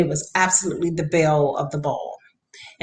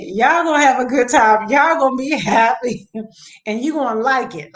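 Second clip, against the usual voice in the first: firm mom; 6 semitones higher than usual. Retold or imitated. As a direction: lay down the law with a laugh. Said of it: "I said this".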